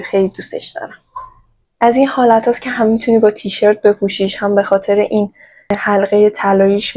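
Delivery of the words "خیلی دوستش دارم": fond whisper. Real, not acted. Fond aside about the item.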